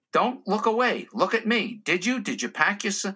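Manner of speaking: insistent questioner. Acted. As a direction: lightly play a pushy security questioner. Acted insistent airport questioner.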